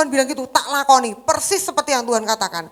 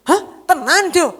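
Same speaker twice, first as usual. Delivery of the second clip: louder astonished question; surprised mother; 5 semitones higher, 7 dB louder, slower than usual. Retold or imitated. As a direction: react stunned. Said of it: "Saya tuh kaget". (ibu kaget dengan suara keras)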